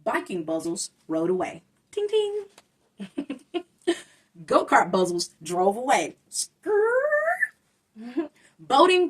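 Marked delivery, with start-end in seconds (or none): 1.9-2.4 high bell chime sound; playful high ting ting; bike bell ting. Acted. 6.3-7.4 high screeching skrrr; cartoon tire squeal; tire skid. Go-kart skid sound.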